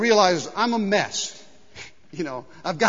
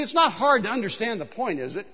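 ordinary delivery

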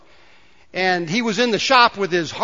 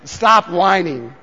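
preacher's drawn-out refrain; drawn-out slogan; 4 dB louder, much slower than usual. Shown as a demonstration. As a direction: stop whining slow and deliberate.

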